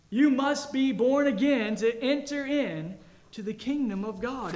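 "You must be born again" proclaimed loud; forceful preaching. Acted. Pastor proclaiming the new-birth rule, loud preaching.

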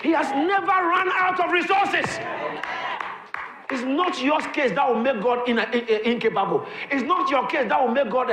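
high, loud revival preaching; fiery preaching. Acted. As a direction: shout the promise over the congregation. Preaching at full force.

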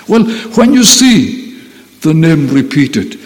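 ordinary talk

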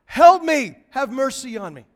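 loud, high shout for help, then easing; crying out. Retold or imitated. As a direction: cry out for mercy from a distance. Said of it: "That's what she's crying out".

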